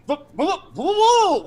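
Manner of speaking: high, rising frantic repeats; panicky Red Cap. Acted. Red Cap raising his mace at Anduriel, high frantic pleading. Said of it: "and he goes".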